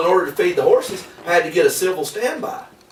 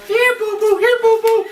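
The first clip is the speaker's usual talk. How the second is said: high, sweet coaxing call; coaxing the dog. Retold or imitated. Deputy on hands and knees coaxing the Airedale, high sing-song. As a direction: lure the dog in close, high and cute.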